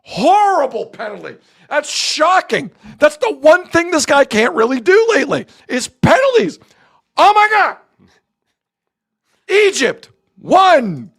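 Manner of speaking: high, loud, emphatic disbelief; aghast play-by-play. Real, not acted. Sportscaster reacting to Messi's missed penalty, aghast and shouting. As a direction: hammer out the shock, then announce the score. Live commentary after the miss.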